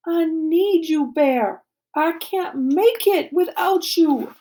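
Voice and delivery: slow, loud, high pleading; desperate plea. A narrator's reading. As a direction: stretch out each word as you beg. Pleads with friend to return.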